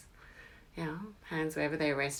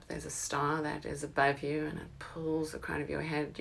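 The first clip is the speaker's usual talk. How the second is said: normal talking voice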